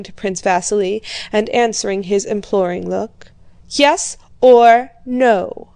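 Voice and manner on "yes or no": impatient demand. A narrator's reading. Old prince insists on answer.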